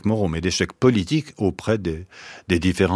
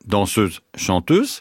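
each word set apart, last one pitched up; audible air quotes; slower than usual. Real, not acted. Guest hints at a euphemism to the radio host, audible air quotes. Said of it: "Vous faites des guillemets".

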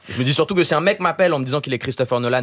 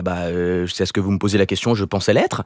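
deflated, doubtful reply in a low register; hesitant retold answer; 9 semitones lower than usual. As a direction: act thrown by the question, voice dropped low. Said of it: "Moi j'étais là".

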